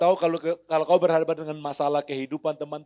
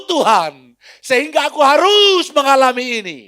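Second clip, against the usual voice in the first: loud, much higher, urgent preaching; preacher exhorting the congregation at full force; 10 semitones higher, 10 dB louder than usual. Real, not acted. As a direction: drive the exhortation home.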